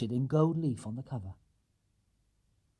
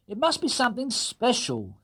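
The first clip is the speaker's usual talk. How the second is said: higher, wry dad voice; higher teasing dad; teasing father; 10 semitones higher than usual. Acted. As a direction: tease about the parcel. Mr Brown teasing.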